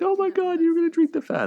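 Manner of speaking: very high-pitched imitated squeal of delight; mock amazed gushing. Imitates Rachel's delighted reaction.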